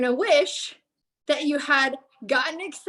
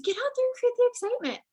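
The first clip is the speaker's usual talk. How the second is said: excited; 10 semitones higher, faster than usual. Real, not acted. Excited push to act.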